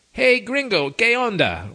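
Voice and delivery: upbeat friendly Spanish hello; friendly greeting. Shown as a demonstration. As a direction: greet him like an old friend. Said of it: "in a glad to meet you kind of way".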